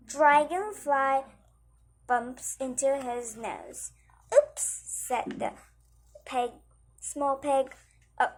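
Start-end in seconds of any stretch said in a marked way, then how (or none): 4.3-4.6 high, loud surprised yelp; startled oops. Acted. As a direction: jump at the sudden bump. Dragonfly bumps pig's nose.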